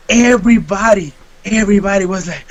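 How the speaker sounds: normal talking voice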